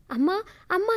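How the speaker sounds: ordinary delivery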